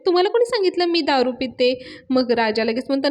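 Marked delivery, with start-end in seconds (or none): 0.0-1.7 higher, mock-indignant question; irritated retort. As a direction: act out a touchy comeback. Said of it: "Avni चिडते आणि म्हणते". (चिडून विचारल्याची नक्कल)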